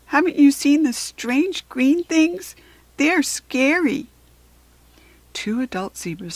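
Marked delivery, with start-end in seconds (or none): 0.0-4.1 higher, slow, frightened foal; a small creature afraid; scared little zebra. A narrator's reading. Ziggy telling fairies about goblins, scared. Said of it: "in a breathless voice".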